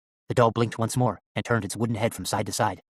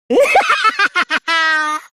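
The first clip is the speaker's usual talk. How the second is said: loud, very high evil doll cackle; long shrill puppet laugh; 10 dB louder than usual. A narrator's reading. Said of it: "uttered a shrill laugh".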